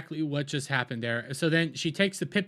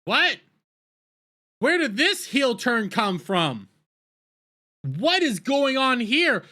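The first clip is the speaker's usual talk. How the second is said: high, loud, slow outraged questions; shocked disbelief; 8 semitones higher, 4 dB louder, slower than usual. Real, not acted. Reviewer reacting to the villain's heel turn, disbelieving. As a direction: demand answers, stunned by the twist.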